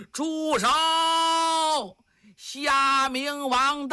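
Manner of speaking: long-drawn, high-pitched herald's call; high shout. Acted. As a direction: call it out across a battlefield, stretching each word. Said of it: "有人高喊呐".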